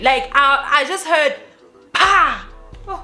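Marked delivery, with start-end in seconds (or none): 1.9-2.5 sharp, much higher "pa" like a slap; imitated slap sound. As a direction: mimic a loud smack with your mouth. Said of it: "like a smack".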